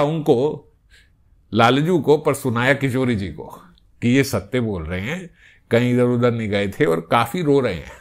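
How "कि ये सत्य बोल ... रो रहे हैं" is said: ordinary delivery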